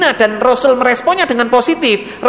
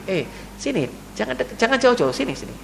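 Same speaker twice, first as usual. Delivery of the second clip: soft beckoning call; 8 dB quieter than usual. Acted. Prophet calling the youth close.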